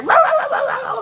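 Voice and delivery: high, loud playful dog barks; dogs barking in words. Retold or imitated. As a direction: bark it out like an excited puppy. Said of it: "They're like".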